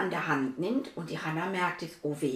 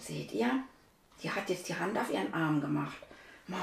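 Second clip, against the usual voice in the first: ordinary delivery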